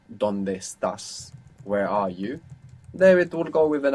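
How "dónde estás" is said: "¿Dónde estás?" said slowly, each word clear; slow, careful "¿Dónde estás?"; slow model question. Shown as a demonstration.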